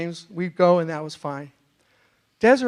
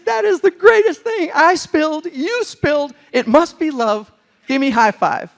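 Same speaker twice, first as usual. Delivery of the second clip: giddy excitement; 8 semitones higher, 6 dB louder than usual. Retold or imitated. Retelling his giddy date reply.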